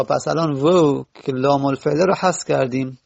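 usual speaking voice

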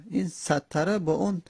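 ordinary talk

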